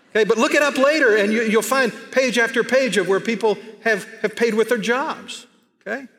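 preacher picks up speed after the phone joke, excited; excited burst. Real, not acted. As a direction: rush ahead with rising energy.

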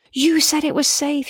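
high, yelled blame on YOU; yelling. A narrator's reading. Daughter yelling blame at her mum from the back seat. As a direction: yell it back at her mother. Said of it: "she yells".

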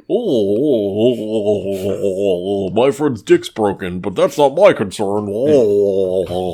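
drawn-out, crooning mock indifference; mocking singsong. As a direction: taunt him with long singsong oohs. Mocks co-host as callous braggart, singsong.

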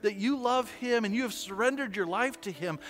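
sermon climax over music; preaching. Acted. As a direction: drive the message home like a pastor.

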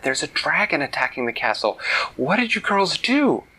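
urgent, voice climbing high on the question; alarmed fairy. Acted. Fairy asks the girls about the dragon attack, alarmed. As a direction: sound the alarm and demand an answer.

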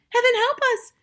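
very high, loud plea; mother's high-pitched panic; terrified cry. A narrator's reading. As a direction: cry out for help in terror. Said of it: "cried the mother".

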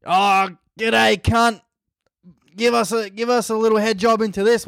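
high, exaggerated Australian drawl; hammed-up matey Australian officer; Australian cop. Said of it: "these are Australians breath testing you".

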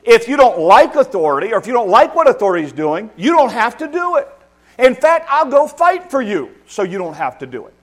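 loud, high fight-for-you promise; mock indulgent parent. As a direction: play the parent who scorns authority. Parent message voiced to child.

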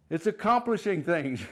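normal talking voice

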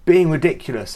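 loud, high, exasperated snap; mock angry telling-off; exasperated outburst. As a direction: lose your temper at the disciples. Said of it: "Jesus doesn't go".

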